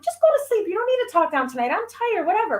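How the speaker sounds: put-on high, exasperated snapping at a kid; exasperated mom. Tired mother snapping at son.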